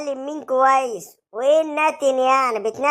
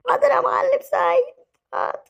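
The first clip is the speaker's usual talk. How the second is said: whimpering submission; 11 semitones higher than usual. Acted. Beaten man submits.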